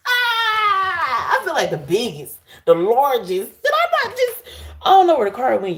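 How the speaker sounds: high, loud, laughing through the words; overjoyed laughing cry; excited laughing shout. Real, not acted. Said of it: "I got too excited".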